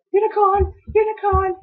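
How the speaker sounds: very high sing-song call. Acted. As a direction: coax the unicorn over. Calling the unicorn.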